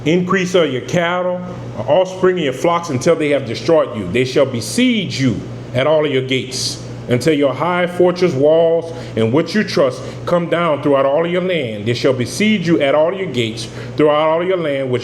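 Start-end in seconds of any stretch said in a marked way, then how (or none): none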